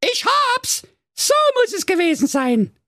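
put-on sudden high-pitched girl's exclamation; eureka cry. A narrator's reading. Blaukäppchen in bed solves the case, high excited cry. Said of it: "und rief".

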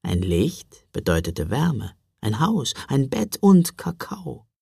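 ordinary delivery

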